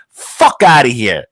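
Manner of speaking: normal talking voice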